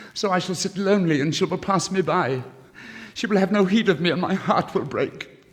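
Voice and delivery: a lovesick scholar building to a wail; lovelorn student. Acted. Student's lament.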